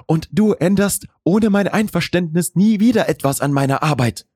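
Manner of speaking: high, firm female character voice; professional woman. A narrator's reading. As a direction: lay down the rule, pitched well up, without shouting. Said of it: "Ihre Stimme war wieder glatt, professionell". (bestimmte, professionelle, sehr hohe Frauenstimme)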